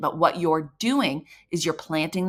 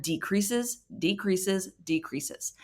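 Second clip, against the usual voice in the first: each word softer and softer; fading away; 6 dB quieter, much slower than usual. Shown as a demonstration. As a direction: let decreases, decreases, decreases dwindle.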